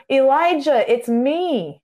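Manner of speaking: loud, high call of his name; God booming at Elijah, loud and high. Acted. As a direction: call out to him loudly. Loud voice God didn't use.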